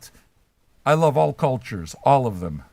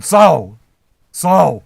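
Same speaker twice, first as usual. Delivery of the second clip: loud, repeated holler of a name; insistent loud call; 9 dB louder than usual. Real, not acted. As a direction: shout for someone's attention. Calling out to a chat member.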